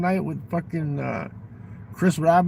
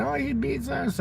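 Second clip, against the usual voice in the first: high, a hint of a tearful girl; lightly voiced upset daughter; 10 semitones higher, 4 dB quieter than usual. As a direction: mimic a scared kid, not fully. Daughter came over crying.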